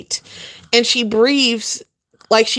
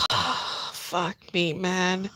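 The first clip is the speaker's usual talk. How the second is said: muttered exasperation; 4 semitones lower, 11 dB quieter, much slower than usual. Real, not acted.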